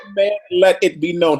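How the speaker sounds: usual speaking voice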